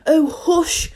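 high, urgent voiced hush; mildly frightened, no true whisper. A narrator's reading. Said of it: "whispered in a frightened tone".

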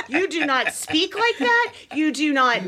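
slow it down and land each word, half laughing; mock scolding. Wife calling out her husband's fake Southern accent, mock-indignant.

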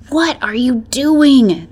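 tense, stretched-out challenge to a sibling; urgent hiss. A narrator's reading. Beta to her sibling stepping toward the fox, hissed. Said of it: "the beta hissed".

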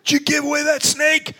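high, shouted accusation; angry boss shouting; mock boss yell. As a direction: bark it at the worker. Fair boss yelling at worker.

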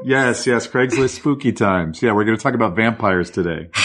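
ordinary talk, nothing marked

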